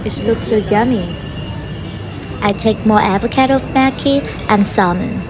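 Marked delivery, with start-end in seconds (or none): none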